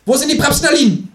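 very high, loud kangaroo shout; high-pitched interrogator; demanding kangaroo. A narrator's reading.

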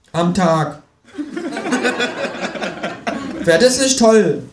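high, salesman kangaroo voice. Acted. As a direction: sell it in a high voice. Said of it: "fährt das Känguru fort".

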